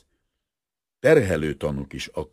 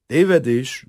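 firm, raised correction; calm, serious; 9 semitones higher, 9 dB louder, slower than usual. A narrator's reading. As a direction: state it gravely, with full voice. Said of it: "mondta nyugodt, komoly hangon". (komolyan, határozottan)

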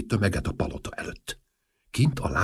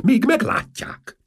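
very high, slow frightened cry; panicked cry; 5 dB louder, slower than usual. Acted. Ministers fear the crowd sees the king, panicked cry. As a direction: squeal it in alarm.

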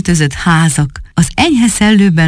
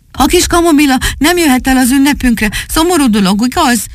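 put-on higher-pitched child's plea; troubled boy; 9 semitones higher than usual. Boy brings old Tóbiás sad news, high-voiced appeal. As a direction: pipe up with the sorry news.

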